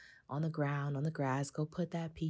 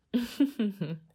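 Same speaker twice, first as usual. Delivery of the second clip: high, loud little laugh; amused chuckle; 6 semitones higher, 6 dB louder than usual. Real, not acted. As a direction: chuckle at your own aside. Amused at her own aside.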